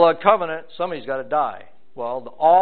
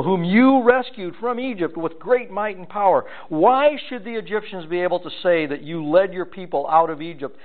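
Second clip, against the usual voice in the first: raised, earnest reading of the plea, slowing at the end; scripture reading; 4 semitones higher than usual. Acted. Preacher reading Moses' plea to the Lord aloud, earnest. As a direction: read it as a pleading prayer.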